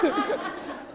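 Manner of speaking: airy high giggle; quick breathy laugh. Real, not acted. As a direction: laugh almost without voice. Laughs after the mom story.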